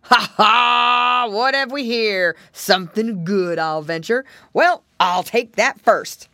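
loud, greedy storybook bear; booming bear with a triumphant ha-ha; growled out. A narrator's reading. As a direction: gloat as a big bear spotting a treat. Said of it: "he growled out".

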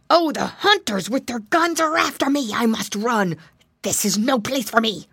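high-pitched, alarmed villain voice; panicked alligator. A narrator's reading. Alligator fleeing imagined hunters, high and panicky. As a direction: lose all menace and wail in alarm.

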